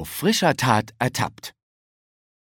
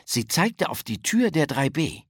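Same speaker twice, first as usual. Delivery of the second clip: ordinary delivery, nothing marked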